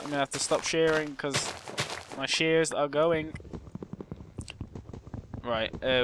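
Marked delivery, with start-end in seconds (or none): none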